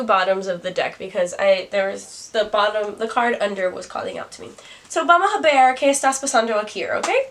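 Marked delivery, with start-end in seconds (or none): 4.9-7.3 loud, higher, hammed-up bad Spanish accent with a sharp high okay at the end; deliberately clumsy Spanish accent, playful. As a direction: play it like a gringo showing off their Spanish. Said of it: "I love to say my Spanish sometimes in a really bad accent".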